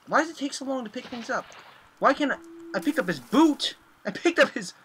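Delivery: high, strained whining complaint; frustrated whine. Real, not acted. Frustrated whining at game controls.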